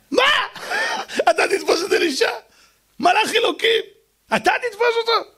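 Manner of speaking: high laughing disbelief; mocking laugh. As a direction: laugh at the absurd idea. Girl laughs at the king.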